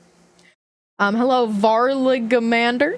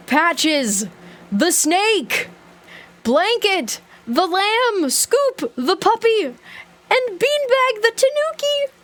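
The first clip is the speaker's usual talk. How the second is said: building excitement; 8 semitones higher, slower than usual. Real, not acted. Announcing mascots again, rising high.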